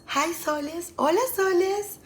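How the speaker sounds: high-pitched, rising sing-song hello; playful greeting. Acted. Playfully greeting viewers by their nickname.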